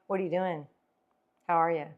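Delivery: low, falling, lifeless greeting; downbeat tone. Shown as a demonstration. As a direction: let each question drop down flat. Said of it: "it just comes on the down low".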